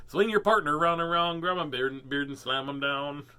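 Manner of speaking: twangy cartoon square-dance chant; mock hillbilly dance caller; Looney Tunes square-dance caller. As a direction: call it like a cartoon hoedown. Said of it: "Looney Tunes kind of".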